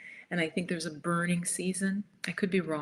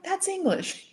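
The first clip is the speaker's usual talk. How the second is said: high, incredulous protest; mock disbelief; 13 semitones higher, 3 dB louder than usual. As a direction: playfully act stunned at the waiter. Retelling disbelief at the waiter.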